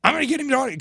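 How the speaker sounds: loud, high, eager outburst; excited fan. Retold or imitated. Woman chasing an autograph, high and excited. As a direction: imitate a starstruck woman in a hurry.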